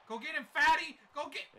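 high, loud urging; urgent yell. Real, not acted. Gamer sending his defender after the runner, urgent yell. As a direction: holler to chase him down.

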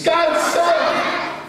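high, loud call. Acted. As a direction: call it out to the sky. Call response.

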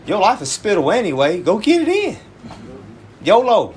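high-pitched mock hype; sarcastic live-it-up urging; mock encouragement. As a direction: egg them on in a high voice. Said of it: "I was talking like this".

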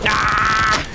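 sharp loud cry of alarm; startled yell. Real, not acted. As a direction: yelp as you get caught. Sudden scare in game.

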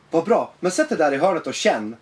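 ordinary talk, nothing marked

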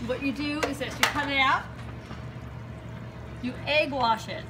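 ordinary talk, nothing marked

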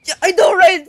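high, loud excited squeal; excited shriek. Real, not acted. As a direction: blurt it out in a thrilled shriek. Excited outburst while gaming.

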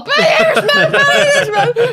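very high, loud hammed-up yell; mock shouting. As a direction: mimic a comic bellowing his opener through laughter. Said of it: "awoken by Sean being all".

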